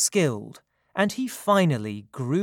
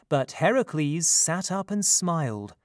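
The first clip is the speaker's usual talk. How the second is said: ordinary delivery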